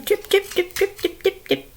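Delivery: high, soft, tiny trips; light high-pitched patter of little hooves; soft, evenly tapped high syllables; little goat's footsteps. Acted. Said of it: "little Billy Goat Gruff came".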